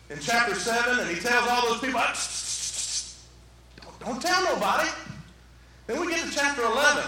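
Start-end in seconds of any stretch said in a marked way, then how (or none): 2.0-3.1 string of soft shushes; repeated hushing. Acted. Preacher acts out Jesus hushing the people, hushing. 3.8-4.9 higher, stammered mock plea, lifting at the end; acted secrecy warning. Jesus telling people to keep quiet.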